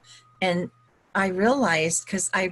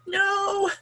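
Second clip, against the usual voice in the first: much higher, squealed "No"; 14 semitones higher than usual. Retold or imitated. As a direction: squeak the no out high in protest. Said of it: "I'm like".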